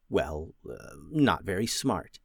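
ordinary delivery, nothing marked

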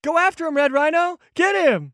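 high-pitched excited shouting on; urgent egging on. Acted. Onlooker urging Red Rhino after the fleeing Kenku, high and urgent. Urging the hero to give chase.

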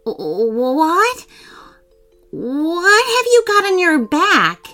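stammer, then high, loud alarm; freaked out. A narrator's reading. Brother spots something on sister's back, freaking out. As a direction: start breathless and stuttering, rise to a cry. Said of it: "That's when Riley freaked".

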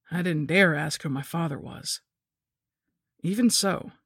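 plain reading voice

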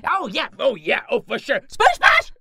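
very high voice rising to a loud cry; high excited character. Acted. Adventurer agreeing with friends, high excited character. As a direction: squeak agreement, then cry out the name.

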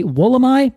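"Wollemi" ending like "my", as a question; Australian pronunciation. Shown as a demonstration. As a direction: ask with "Wollemi" rhyming with "eye". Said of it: "speakers of this word say".

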